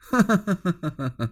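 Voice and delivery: 高声冷笑，嗤之以鼻; 仰头一声冷笑，满是不屑; scornful laugh. Acted. 祢衡冷笑对骂. (轻蔑的冷笑)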